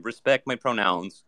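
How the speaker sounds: firm machine request, slowly; woke robot. Acted. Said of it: "it's a woke robot".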